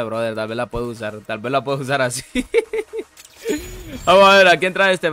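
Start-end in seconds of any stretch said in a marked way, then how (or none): 2.3-3.0 short high-pitched giggle; high little laugh. Real, not acted. Amused by own plan.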